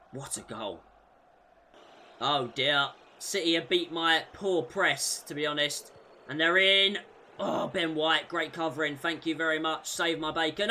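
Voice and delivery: usual speaking voice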